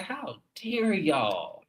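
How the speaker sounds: high, drawn-out mock outrage; pissed. Viewer recalling her reaction to the show, outraged. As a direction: stretch it out, high and scandalized. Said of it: "but I was pissed".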